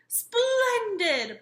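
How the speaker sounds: big booming high exclamation; booming Santa. A narrator's reading. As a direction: boom it out with joy. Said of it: "boomed Santa".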